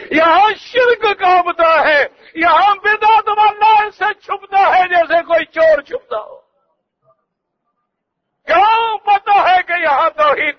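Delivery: high, ringing preacher's cry; preaching at full force. Acted. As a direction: thunder it out to the crowd. Fiery sermon climax.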